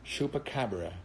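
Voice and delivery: Chupacabra in a lightly put-on American accent. Shown as a demonstration. A Brazilian streamer showing how Americans say it, in an American accent. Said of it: "English, uh, American accent".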